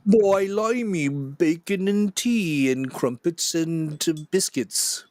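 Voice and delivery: a bad movie Cockney accent, drawn out; over-the-top fake Cockney accent for a laugh; mock Cockney accent.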